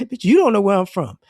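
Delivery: loud mock comeback; indignant retort. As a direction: fire it right back at him.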